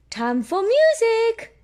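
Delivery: high, slow teacher voice; Madame Gazelle. Acted. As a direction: announce it sweetly to the class. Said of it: "says Madame Gazelle".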